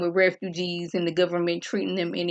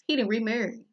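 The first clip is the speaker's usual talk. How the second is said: normal talking voice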